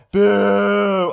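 high, loud boo; mock booing. Booing the retold sweet talker.

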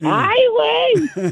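high, slow drawn-out "Ay, güey"; put-on voice. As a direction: stretch it out in a silly high voice. Recurring put-on catchphrase voice.

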